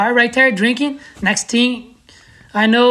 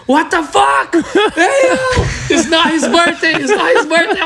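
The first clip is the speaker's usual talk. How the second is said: shocked outburst; 9 semitones higher than usual. Retold or imitated. Friend exposing the fake birthday to everyone, shocked yell. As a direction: blurt it out, caught them lying.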